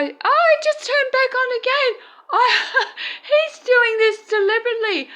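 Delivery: high, loud excited outburst with a laugh; thrilled disbelief breaking into laughter. Real, not acted. Light turns on, excited.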